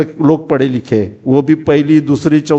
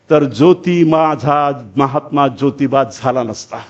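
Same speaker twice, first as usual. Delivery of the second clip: slow, lowered closing line of verse; poem recitation; 4 semitones lower, slower than usual. Acted. Orator finishing Savitribai's poem of thanks, low slow recitation. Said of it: "सावित्रीबाईच्या हस्ताक्षरात लिहिलेली कविता आहे".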